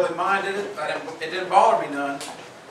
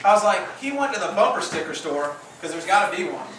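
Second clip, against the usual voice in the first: usual speaking voice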